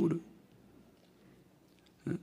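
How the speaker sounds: ordinary delivery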